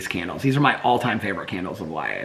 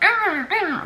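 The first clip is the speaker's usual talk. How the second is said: very high little yips; imitating puppy yips. Owner copies her puppies' talking noises, high yips. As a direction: mimic two puppies chattering back and forth. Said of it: "they go back and forth, like".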